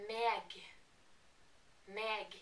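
meg with a hard G sounded; western meg. Shown as a demonstration. A Norwegian teaching the western dialect, meg with G. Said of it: "but they say".

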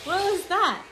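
high loud startled question; surprised. Real, not acted. Owner startled by her parrot's noise, surprised. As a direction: react with surprise to the sound.

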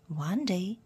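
usual speaking voice

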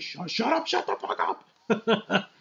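put-on voice, drawn-out slow mock fury; imitating an exasperated Logan; mock Logan snapping. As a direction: drag out the shut up like a fed-up man. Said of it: "He's like".

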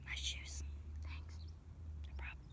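hushed whisper while hiding. Real, not acted. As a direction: whisper so nobody hears. Hiding, unvoiced lines.

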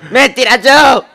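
very high, loud shriek; frantic high yell; screamed command. Acted. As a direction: scream it at full force. Armed standoff, screaming.

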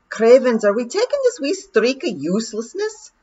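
scornful wee pixie in broad Scots; broad scottish accent. Acted. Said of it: "said Rob".

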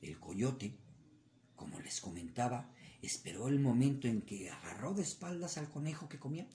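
ordinary delivery, nothing marked

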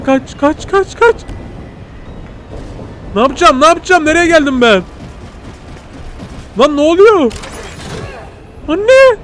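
high, loud frantic panic; being chased. Real, not acted. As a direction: scream for your life while fleeing. Fleeing a blind wolf.

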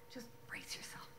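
hushed whisper. Real, not acted. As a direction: breathe it as a secret. Teasing before reading a letter.

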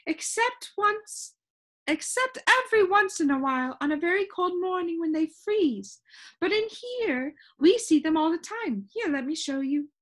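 high-pitched, lively storybook shopkeeper; high voice of a proud expert; Soundkeeper character. A narrator's reading. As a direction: explain it eagerly in a high character voice.

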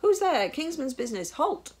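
loud, high challenge; full-voiced, high-pitched shouted order; crying out. A narrator's reading. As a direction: shout like a guard jolted awake. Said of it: "crying out in the damp air".

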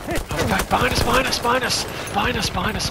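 high, loud panicked yell; combat callout. Real, not acted. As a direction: scream the warning to the team. Enemy cavalry attacking.

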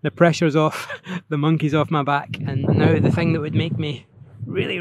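ordinary delivery

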